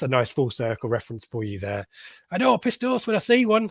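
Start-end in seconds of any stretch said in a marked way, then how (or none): 2.3-3.7 hammed-up quote pitched high; lightly voiced movie character; quoted film line, higher. Podcast host quotes a film for his co-host, mock voice. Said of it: "Thank you, Skip. That's a good quote there".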